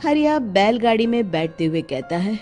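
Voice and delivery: ordinary delivery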